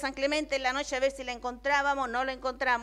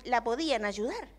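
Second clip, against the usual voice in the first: usual speaking voice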